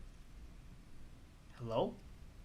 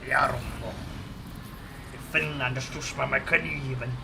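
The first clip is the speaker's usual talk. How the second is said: a deliberately bad Finland Swedish impression; horrible Finland Swedish impression. Mocking an average Finnish guy in a Finland Swedish accent. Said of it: "it's a horrible impression".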